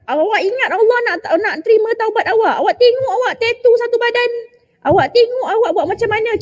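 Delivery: high-pitched, sustained telling-off; acted self-righteous rebuke; judgmental scolding. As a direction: scold a sinner with scornful disbelief. Acts out judging a sinner.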